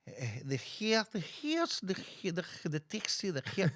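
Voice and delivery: stumbling try at a Greek accent; attempted Greek accent. Shown as a demonstration. Host tries and fails at an old Greek man accent. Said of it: "the old Greek man".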